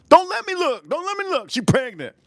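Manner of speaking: high-pitched comic warning; mock alarm. Warning chat his look causes pregnancy, mock alarm. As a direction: jump way up in pitch, playfully panicked.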